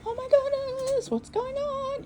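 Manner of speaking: imitating a frantic puppy owner, high-pitched panic; mock frantic owner. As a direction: play the stressed-out owner, hammed up. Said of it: "that you're not frantic, like".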